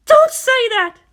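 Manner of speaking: very high, loud outburst; desperate scream. A narrator's reading. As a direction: shriek it in anguish. Aunt screams at boy.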